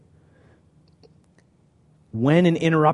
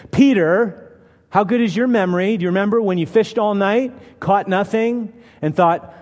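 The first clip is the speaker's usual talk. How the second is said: 1 mild scolding; 8 semitones higher, 5 dB louder than usual. Retold or imitated. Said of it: "probably could have castigated them".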